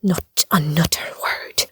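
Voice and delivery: slow, low warning sinking to a whisper; menacing. Acted. Host silences the rambling leprechaun, slow low menace. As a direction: measure out each word as a threat.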